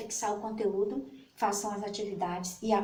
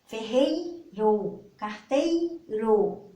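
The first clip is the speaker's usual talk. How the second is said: slow, syllable by syllable; much slower than usual. Shown as a demonstration. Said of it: "completei com duas sílabas".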